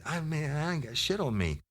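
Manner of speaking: high-pitched mock whining protest; high acted plea of innocence; suspect's denial. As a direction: imitate a defensive suspect, voice way up.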